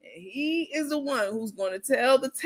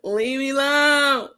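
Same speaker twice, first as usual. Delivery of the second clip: slow mock plea; 3 semitones higher, 3 dB louder, slower than usual. Repeating clip line, mocking.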